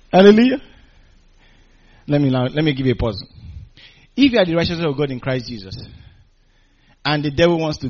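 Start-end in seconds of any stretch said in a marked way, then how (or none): none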